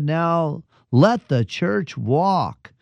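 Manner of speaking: high, very slow, drawn-out pulpit call; a hint of an old-time revival preacher; country preacher. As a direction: stretch each word like a fired-up country preacher.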